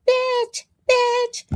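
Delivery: calling the cat, pitched high; sing-song high-pitched call of the word bitch; high mock call to cat. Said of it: "I'm like".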